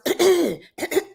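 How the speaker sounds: hard, imitated throat-clear. Showing why she stopped talking, throat clearing. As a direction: act out failing to clear your throat. Acts out failed attempts to talk.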